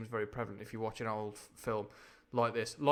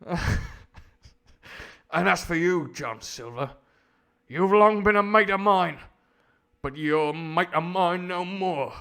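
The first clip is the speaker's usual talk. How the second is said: loud, slow, high melodrama; hammed-up upper-class actor playing a working Londoner; fifties Hollywood cockney; 7 semitones higher, 12 dB louder, slower than usual. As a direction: renounce a friend like an old-film actor. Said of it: "the voice I'm doing now".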